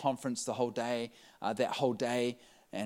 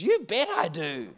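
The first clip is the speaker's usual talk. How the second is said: eager reply; 10 semitones higher, 7 dB louder, slower than usual. Retold or imitated. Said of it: "she goes".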